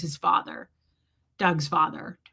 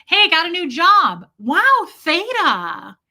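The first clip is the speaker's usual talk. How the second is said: hammed-up excitement, loud and high; uptone happy; 7 semitones higher, 9 dB louder, slower than usual. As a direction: gush in mock delight with swooping pitch. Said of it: "super uptone, happy stuff".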